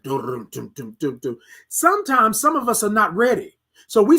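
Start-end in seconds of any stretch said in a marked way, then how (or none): 0.0-1.4 low, soft drum beats; imitated drums. Showing how the song's band comes in, low drum imitation. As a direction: mouth the band's drum pattern low and quiet. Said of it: "they come in with the drums and the band".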